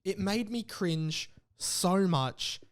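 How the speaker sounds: ordinary talk, nothing marked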